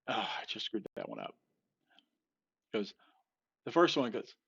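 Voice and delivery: ordinary talk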